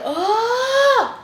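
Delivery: high startled yelp; mock shout. Patient waking the girl beside her, high yelp. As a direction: let out a sudden high cry.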